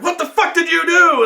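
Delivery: high-pitched incredulous demand; acted outrage. Game master as the group confronting their teammate, acted outrage. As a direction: demand it in imitated alarm.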